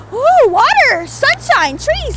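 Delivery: high, loud oohing wonder; acted cave-woman amazement; amazed. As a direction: marvel at each thing like a first human. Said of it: "everything was amazing to me again".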